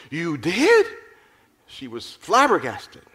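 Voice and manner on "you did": astonished. A narrator's reading. Rebecca flabbergasted.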